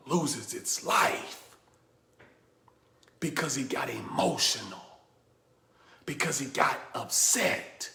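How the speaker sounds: low and soft, with long pauses; hushed, solemn. Real, not acted. Speaker telling how the snake died from emotion, hushed. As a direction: let the story's sad end fall nearly to a whisper. Somber end of the snake story.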